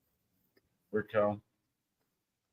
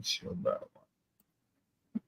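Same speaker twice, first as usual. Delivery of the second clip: whispered aside; 15 dB quieter than usual. Real, not acted. Muttering to himself.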